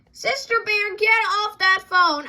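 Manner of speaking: bossy sibling yelling; demanding yell. Acted. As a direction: holler it across the house. Brother demands the phone.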